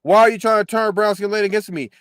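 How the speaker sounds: usual speaking voice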